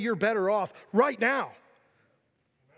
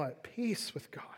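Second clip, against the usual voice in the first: whispering; 8 dB quieter than usual. Real, not acted. Pastor softly assures the congregation.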